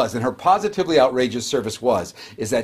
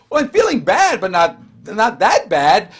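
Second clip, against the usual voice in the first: shocked; 5 semitones higher, 5 dB louder than usual. Retold or imitated. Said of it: "I said".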